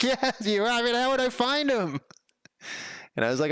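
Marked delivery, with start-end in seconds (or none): 0.0-2.0 mock defensive. Speaker mock-defensive about alphabetizing.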